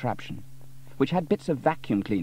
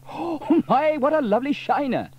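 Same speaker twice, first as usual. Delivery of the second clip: very high, loud mock surprise; both gasping at a shiner; delighted gasp; 8 dB louder than usual. A narrator's reading. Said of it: "they both said together".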